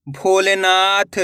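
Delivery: slow spelling. Shown as a demonstration. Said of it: "अब अपन direct मात्रा का उच्चारण करके पढ़ेंगे".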